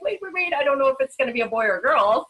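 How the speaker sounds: high "wait, wait, wait", then a rushed warning; flustered caution. Retold or imitated. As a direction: hurry to temper the girl's hopes, half laughing. Mom cautioning excited daughter.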